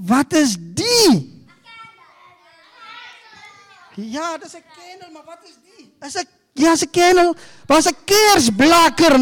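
put-on bright, high storytelling to kids; talking to children. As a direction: coax the little ones like a children's pastor. Talks to children, props.

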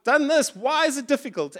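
a hint of a whining Jeremiah; complaining prophet. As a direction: plead like a frustrated prophet, mildly. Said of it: "he's like".

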